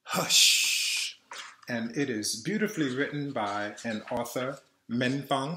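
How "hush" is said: a long breathy shush; shushing hush. Shown as a demonstration. Reading the book title as a shush. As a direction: shush like quieting a room.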